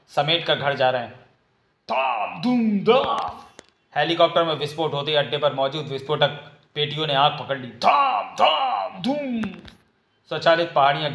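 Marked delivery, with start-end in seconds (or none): 1.9-3.4 high voiced booms; explosion sound effects. 7.7-9.4 high voiced booms; explosion sound effects.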